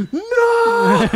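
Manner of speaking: very high, wailed mock no; hammed-up childish protest; horrified child. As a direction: cry out like a kid losing a toy. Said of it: "my kid's gonna be".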